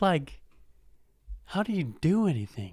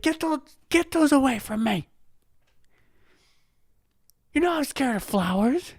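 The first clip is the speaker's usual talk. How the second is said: mock girlfriend; 10 semitones higher than usual. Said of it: "She's like".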